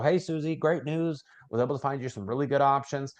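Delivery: upbeat sales pitch. Shown as a demonstration. Agent opening a call with client Susie, cheerful pitch. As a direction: sell it as great news, up and positive. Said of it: "Here's how I phrase that to somebody".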